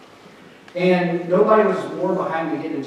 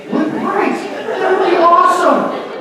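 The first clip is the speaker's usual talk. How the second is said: dreamy fantasy; 13 semitones higher, 6 dB louder, much slower than usual. Pretend. Mock dreamy fantasy aside.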